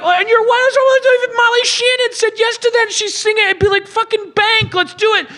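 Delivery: high, eager pitch; mock eager Santa. Host voicing what Santa should say, high and eager. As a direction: sound thrilled at the deal. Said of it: "And I was like".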